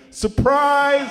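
high, loud mock cheer; panicked surprise shout. Family caught around the car, acting panicked shout. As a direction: blurt it out in a hammed-up panic. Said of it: "we panicked and went".

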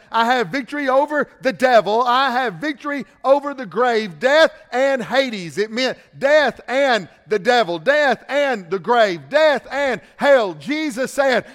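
loud, slow, hammered preaching; preaching at full force. Acted. Preacher thundering victory over death to the congregation. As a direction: drive each line home to the congregation.

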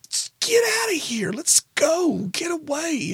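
high, urgent mock-panicked dad; panicked urging. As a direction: hustle the kid away in a high voice. Dad urges son to flee scene.

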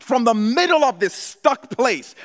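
loud, high preaching call; preaching at full force. Real, not acted. Preacher calling out to the church, loud and high. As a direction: lift the room with it.

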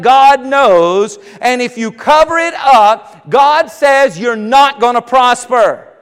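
preaching. Acted. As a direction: press it home to the congregation. Preaching at rising force.

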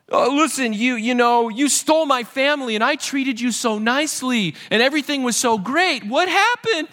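hammed-up whine of a self-pitying relative; whiny pity-party Laban. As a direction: play the wounded father-in-law begging for sympathy. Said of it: "he has this pity party".

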